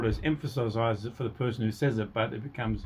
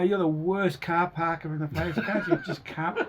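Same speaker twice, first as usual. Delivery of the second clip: higher-pitched, half-laughing mock rant; heightened frustration; 6 semitones higher than usual. Frustrated person berating someone over parking, mock-heightened rant. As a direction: play at an exasperated telling-off. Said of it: "you're fairly heightened if your voice goes".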